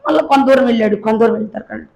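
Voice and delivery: ordinary delivery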